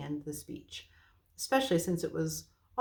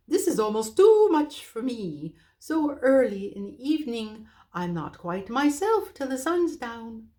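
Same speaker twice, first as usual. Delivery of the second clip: owl voice; 5 semitones higher, 6 dB louder than usual. Acted. Drowsy owl voice.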